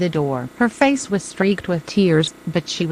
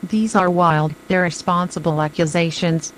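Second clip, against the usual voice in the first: ordinary delivery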